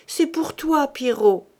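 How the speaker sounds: women insisting to Pierrot down the well, loud call. Acted. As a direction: shout so the dog hears it's his. Calling down to the dog.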